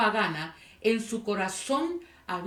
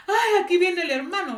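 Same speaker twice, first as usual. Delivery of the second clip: high, excited exclamation; mock delighted outburst; acted relief; 9 semitones higher, 5 dB louder than usual. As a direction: pipe up as if help just arrived. Stranded driver spots a fellow churchgoer.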